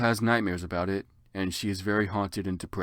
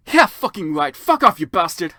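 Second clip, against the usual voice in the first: louder, higher angry scream; 7 semitones higher, 10 dB louder than usual. Acted. Ebony screaming at Vampire, loud mock fury. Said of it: "I screamed".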